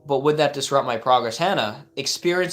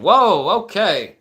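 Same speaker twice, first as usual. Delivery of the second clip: much higher and louder, genuinely taken aback; startled whoa; 8 semitones higher, 9 dB louder, much slower than usual. Real, not acted. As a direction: jolt back in real surprise. Shocked by a viewer's story.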